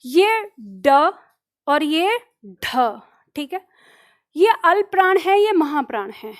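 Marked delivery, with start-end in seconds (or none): 0.1-1.1 the letter ड drawn out very slowly; slow careful ड. Shown as a demonstration. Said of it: "जब आप इनका उच्चारण करोगे". 1.7-3.0 the letter ढ slow, with a strong breath; slow aspirated ढ. Shown as a demonstration. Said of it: "जब आप इनका उच्चारण करोगे".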